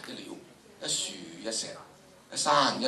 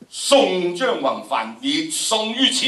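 loud, slow, every word hammered down; rising higher and louder into a final weighty verdict; weighty declamation; 7 semitones higher, 9 dB louder, slower than usual. Shown as a demonstration. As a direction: land each word like a heavy blow. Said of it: "一字之力，重若千斤".

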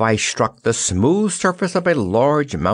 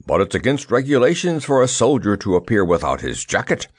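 ordinary delivery

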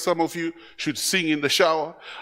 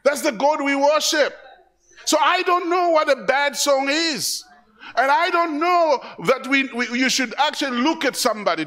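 preaching at full force; 8 semitones higher than usual. Acted. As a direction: rouse the congregation with passion.

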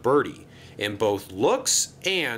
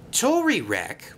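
ordinary delivery, nothing marked

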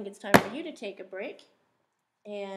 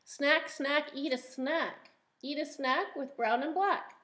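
bright sing-song read-aloud, a touch higher; reading a picture book aloud; 4 semitones higher than usual. Acted. Teacher reading a rhyming book to the class, sing-song. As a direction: read each rhyme out to little listeners.

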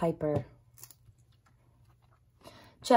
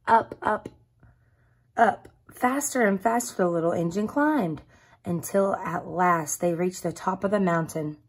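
usual speaking voice

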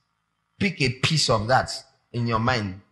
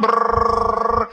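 mock sung brrr; 8 semitones higher, 5 dB louder than usual. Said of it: "fire pouring down".

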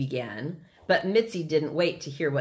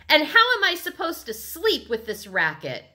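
high indignant protest; irate complaint; 10 semitones higher, 4 dB louder than usual. A narrator's reading. Libby complains to the noisy kids, irate. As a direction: complain sharply about being woken.

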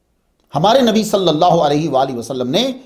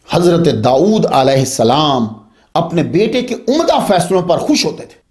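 normal talking voice

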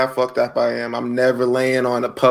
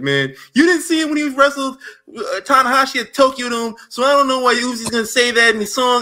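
high, mocking know-it-all fan voice; gatekeeping fan; 12 semitones higher than usual. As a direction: whine it like a snobby superfan. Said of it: "It was like".

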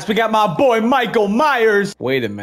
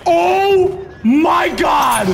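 drawn-out gasp; 7 semitones higher, much slower than usual. Real, not acted. Stunned during fight.

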